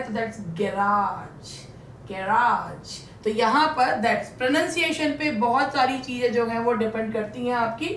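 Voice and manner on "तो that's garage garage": garage said very slowly and low; slow model pronunciation. Shown as a demonstration. Teacher repeats garage slowly for the class. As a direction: draw out garage syllable by syllable. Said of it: "pronunciation पे depend करता है".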